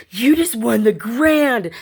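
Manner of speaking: high, loud, drawn-out announcement; slow, emphatic, thrilled exclamation; excited bystander. Retold or imitated. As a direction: break huge news to the winner beside you. Lady next to her breaks news.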